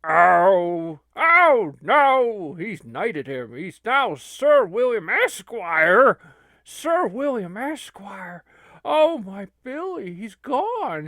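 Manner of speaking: loud, high wails of dismay; mock anguish. Onlooker watching Billy get knighted, wailing mock anguish. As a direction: lament it with hammed-up grief.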